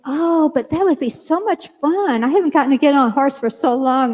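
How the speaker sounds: high, wistfully eager pitch; retold gushing persuasion; coaxing enthusiasm. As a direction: talk someone into it with delight. Enticing child to ride.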